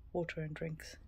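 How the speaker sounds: ordinary talk, nothing marked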